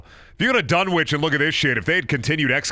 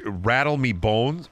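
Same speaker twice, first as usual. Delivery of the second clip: normal talking voice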